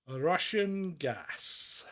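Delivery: very slow, dropped punchline; deadpan reveal. Acted. Revealing the quiz answer to co-host, slow and deadpan. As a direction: let it land slowly.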